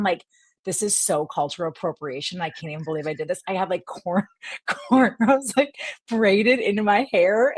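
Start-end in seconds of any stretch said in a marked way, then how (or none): none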